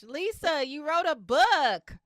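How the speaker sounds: loud, high, slowed amazement; excited. Real, not acted. Host cheering the guest's first book, loud and thrilled. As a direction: sell the news like a big reveal.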